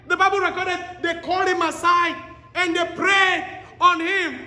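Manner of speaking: fiery sermon delivery; full-force preaching. Acted. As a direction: preach it to the back row. Preacher building to the climax.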